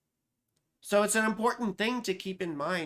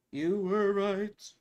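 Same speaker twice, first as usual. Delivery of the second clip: very slow, stretched-out words; drawn-out concession; much slower than usual. Real, not acted. Host conceding to a viewer, slowly drawn out. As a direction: stretch out each word of the concession.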